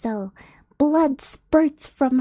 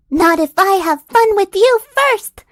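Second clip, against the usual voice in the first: high, loud battle cry; the swordswoman yelling a counterattack; defiant shout; 8 semitones higher, 9 dB louder than usual. Acted. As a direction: hurl it back at the ogre.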